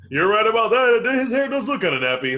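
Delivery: put-on, over-enthusiastic commentator; hammed-up agreement. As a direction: agree loudly as the imagined co-commentator.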